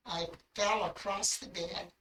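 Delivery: ordinary talk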